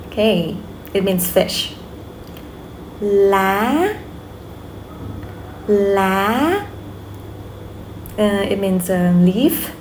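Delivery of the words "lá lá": "Lá" gliding up at the end; rising tone. Shown as a demonstration. Teacher models a practice word, rising tone. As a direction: lift each "Lá" like a question. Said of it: "raise your voice a little bit".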